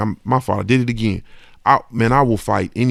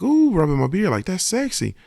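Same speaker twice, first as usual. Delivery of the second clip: aunt's flirty coo; 5 semitones higher than usual. Retold or imitated. Nephew imitating his aunt cooing over his shaved head, flirty high coo. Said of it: "was like".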